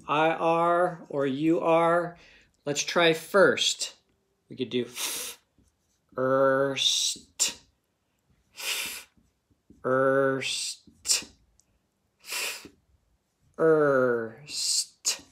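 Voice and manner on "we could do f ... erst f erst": "f-" whispered, then "erst" voiced, slowly apart; tapping out sounds. Shown as a demonstration. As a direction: split "first" into "f-" and "erst" with pauses. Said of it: "tapping out the sounds".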